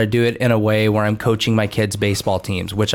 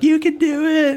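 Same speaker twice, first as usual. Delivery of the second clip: much higher, sing-song cheer; mock cheering family. Family cheering on the stranded runner in the group chat, mock cheerful. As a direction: imitate the family's breezy encouragement. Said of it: "everyone's like".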